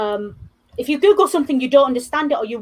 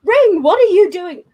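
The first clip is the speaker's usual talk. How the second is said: raised, higher call through the door; 6 semitones higher, 5 dB louder than usual. Retold or imitated. Housemate calling through the bathroom door, raised and suspicious. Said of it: "calling out to the bathroom".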